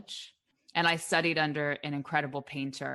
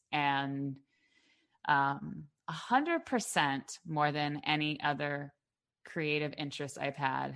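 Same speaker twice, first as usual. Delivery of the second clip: ordinary delivery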